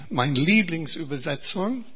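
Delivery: normal talking voice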